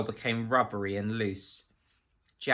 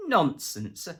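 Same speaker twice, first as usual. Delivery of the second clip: blustering dismissal of the suggestion; barking laugh; 8 semitones higher than usual. A narrator's reading. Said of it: "barked with laughter".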